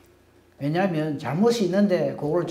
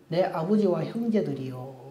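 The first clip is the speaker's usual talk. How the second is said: ordinary delivery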